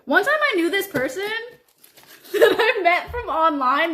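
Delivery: giggling through the story opening; laughing. Real, not acted.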